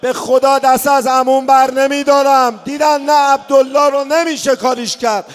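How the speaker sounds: loud rising lament, crying out the questions; impassioned mourning sermon; preaching at full force. Acted. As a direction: build to an anguished public outcry.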